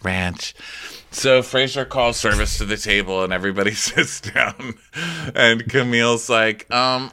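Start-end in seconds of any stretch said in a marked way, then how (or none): none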